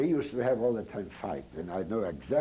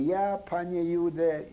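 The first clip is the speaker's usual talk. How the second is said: high, slow, loud retold blurt; eager answer; 9 semitones higher, 4 dB louder, slower than usual. Boy answers German soldier.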